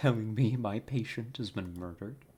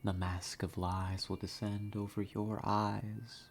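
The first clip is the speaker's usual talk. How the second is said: mysterious intro narration; 3 dB quieter than usual. Acted. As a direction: draw the listener in through the mist, half whispering. Dramatic whispered intro narration.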